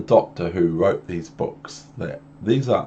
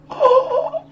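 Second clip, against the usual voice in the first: fish squeal; 4 dB louder than usual. Acted. Fish squeals after its plea.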